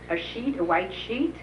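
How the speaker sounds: usual speaking voice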